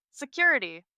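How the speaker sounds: high, loud call for help. A narrator's reading. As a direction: call across the room. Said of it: "Piper calls out".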